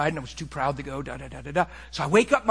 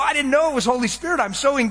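ordinary talk, nothing marked

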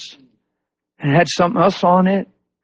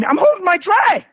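full of attitude. Retold or imitated. Customer holding her tray, snapping back with attitude. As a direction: imitate her with exaggerated sass. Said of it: "She's full of attitude".

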